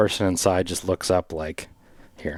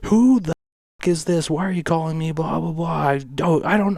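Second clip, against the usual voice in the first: imitating angry yell; 9 semitones higher than usual. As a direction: play the furious neighbour on the intercom, hammed up. Angry stranger on the buzzer.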